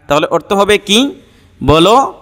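ordinary delivery

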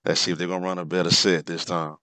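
ordinary talk

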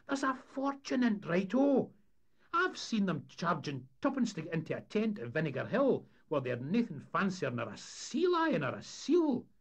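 high, loud Scots schemer pitching a money-maker; enthusiastic. A narrator's reading. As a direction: sell the scheme with excitement. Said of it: "he said with enthusiasm".

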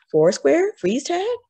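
high, hopeful questions; eager guessing. A narrator's reading. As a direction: toss out guesses like an excited kid. Eager pupil guesses games.

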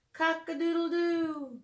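light mock rooster call; rooster crow.

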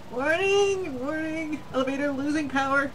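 loud high robotic warning; mock alarm announcement, loud and high. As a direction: blare it like a machine alarm. Voicing elevator alarm.

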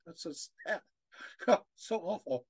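soft, choked-up voice, nearly breaking; fighting back real tears. Real, not acted. Lecturer moved by a medieval father's lament, choked up. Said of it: "Sorry, it choked up here".

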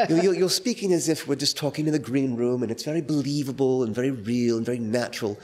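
lisping Stoppard giving notes; Tom Stoppard impression. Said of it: "This is how he has a kind of a lisp".

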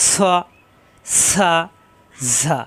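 স, সা, ঝা with air hissing out on each consonant; whistling sibilants. Shown as a demonstration. Teacher demonstrating whistle letters, hissing air on স, সা, ঝা. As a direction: let breath whistle through the teeth on স, সা, ঝা. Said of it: "প্রত্যেকবার বাতাস বের হবে".

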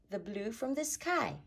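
usual speaking voice

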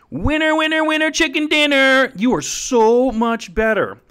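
very high, loud celebratory shout; game show cheer. Pretend. Celebrating viewers with a superior plan, loud high cheer.

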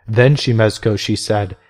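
plain reading voice

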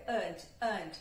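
"and" said with a reduced, light schwa; schwa syllable. Shown as a demonstration. Teacher isolates the schwa for learners. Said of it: "que é o schwa".

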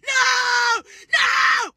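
high, loud anguished scream; screaming and crying. Real, not acted. Woman screaming at voters who turned against her. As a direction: wail it out in despair.

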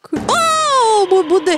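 long high scream, then panicked; terrified scream. Real, not acted. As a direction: shriek then stammer in fright. Jump scare in horror game.

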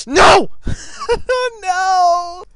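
high, loud cries broken by laughter; real loud wail of protest; dismayed shouting. Real, not acted. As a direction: yell no in laughing despair. Loses target in game.

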